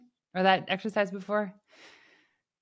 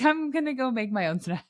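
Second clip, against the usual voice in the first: higher, light mock child voice; sulky kid voice; 6 semitones higher than usual. Parent imitating a huffy child, pitched up. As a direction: play the huffy kid going off. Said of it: "It's like".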